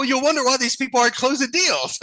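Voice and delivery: high, exasperated complaint; mock boss rant. Boss griping to coworkers about idle callers, exasperated. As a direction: vent at the slackers. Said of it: "I go".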